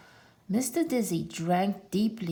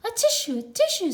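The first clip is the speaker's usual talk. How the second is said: sneezing words; 12 semitones higher, 5 dB louder, much faster than usual. A narrator's reading. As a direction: sneeze out the words high and loud. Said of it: "sneezed the pig".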